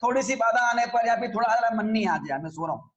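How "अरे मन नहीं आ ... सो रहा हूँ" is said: lazy excuse. Acted. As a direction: let the excuse tail off lightly, low and sleepy. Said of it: "ये आलसी प्रवृत्ति का होता है". (हल्का आलसी अंदाज़, धीमी होती आवाज़)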